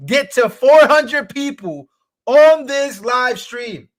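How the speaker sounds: loud, high, drawn-out hype; rally the crowd at the top of your voice; excited streamer shouting a challenge; hyped-up rallying cry. Real, not acted. Rallying viewers toward 400.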